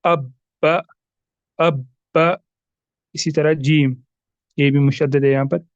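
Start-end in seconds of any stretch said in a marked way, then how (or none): 0.0-1.9 अब with the ब pressed hard and bounced; emphasis on a doubled qalqala letter; emphasized qalqala. Shown as a demonstration. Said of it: "हम इस तरह पढ़ेंगे".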